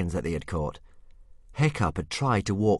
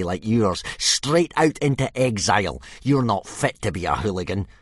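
threatening bully; 4 semitones higher, 6 dB louder than usual. A narrator's reading. Snotlout threatening.

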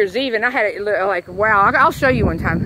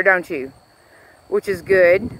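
ordinary talk, nothing marked

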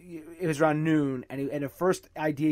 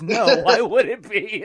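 mock incredulous, higher and louder through a laugh; like I'm stupid; 6 semitones higher, 8 dB louder, slower than usual. Retelling the friend answering as if he's stupid, mock scoffing. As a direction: act as if the question is obviously dumb. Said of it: "looks at me like I'm stupid or crazy".

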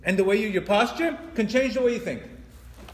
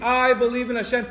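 higher, louder, fervent cry; screamed declaration of faith; 5 semitones higher, 6 dB louder than usual. Shown as a demonstration. Preacher showing how faith must be screamed, raised fervent voice. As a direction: proclaim belief with feeling, voice raised. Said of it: "You have to scream".

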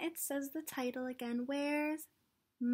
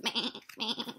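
lamb bleat. Acted. Lambs on the page.

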